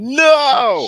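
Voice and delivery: very high, loud drawn-out cry; scared outcry. Retold or imitated. Fan seeing Giannis slip and fall, panicked shout. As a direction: wail it out in panic. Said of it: "I got scared for a second".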